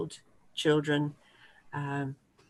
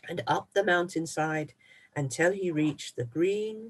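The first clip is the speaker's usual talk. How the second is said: ordinary delivery, nothing marked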